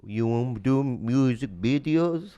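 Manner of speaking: slow, imitated Korean-accented English. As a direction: draw it out in a mock Korean accent. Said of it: "And he goes".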